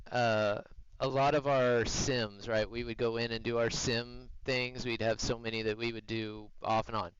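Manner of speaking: ordinary delivery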